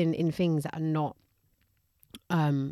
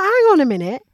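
indignant shout; 9 dB louder than usual. Retold or imitated. Outraged retelling of her thoughts.

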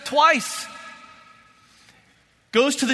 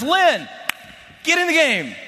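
coach's shout; 5 semitones higher than usual. Retold or imitated. Coach orders Lin into the game with a shout. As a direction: call him off the bench.

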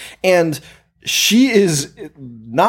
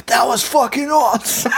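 higher, hyped-up dude voice; a fan in the crowd cheering the air guitar, hyped; impressed fan; 9 semitones higher than usual. Retold or imitated. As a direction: gush like a wowed bystander. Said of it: "some guy's like".

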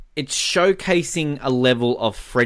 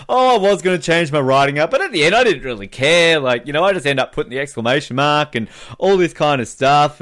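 smug Craig voice; 3 semitones higher, 4 dB louder than usual. Retold or imitated. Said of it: "he's basically there going like".

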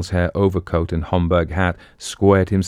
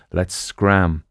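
ordinary delivery, nothing marked

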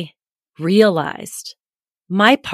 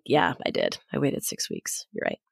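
low, soft retold admission; owning it; 5 semitones lower, 11 dB quieter than usual. Mother owning the six-week wait to her son, quietly. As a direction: concede it quietly and simply. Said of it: "I could just own it".

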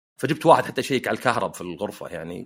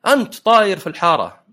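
imitating an accusing driver, louder and higher; raised-voice retold accusation; mock confrontation; 7 semitones higher, 5 dB louder than usual. Retold angry driver confronting him. (تقليد سواق معصب يعاتب بصوت مرتفع)

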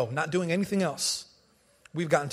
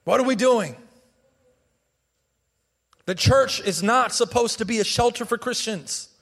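higher, louder sermon voice with punched key words; forceful preaching; 5 semitones higher, 5 dB louder than usual. Real, not acted. Preacher challenging the church over the gospel, preaching forcefully. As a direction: preach it out to the congregation.

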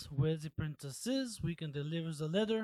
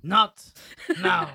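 loud, rising snap; sharp retort; 10 semitones higher, 13 dB louder than usual. Acted. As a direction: cut her off, loud and high. Player snaps at party member.

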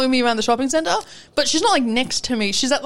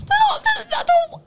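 high squeaky laughing splutter; flustered laughing. Real, not acted. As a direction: laugh while failing to defend yourself. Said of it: "I don't know how to defend it".

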